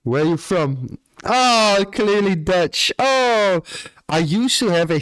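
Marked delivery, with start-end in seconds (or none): 1.2-3.6 loud, high "oh" of realising; surprised exclamation. Real, not acted. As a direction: burst out as it clicks. Reacts to viewer's accent.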